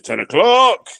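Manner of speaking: loud, high announcement of the hour; announcing call. Retold or imitated. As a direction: call it out like a town crier. Acting out his nightly announcement.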